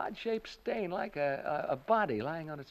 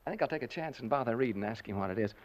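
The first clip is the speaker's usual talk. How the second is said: ordinary delivery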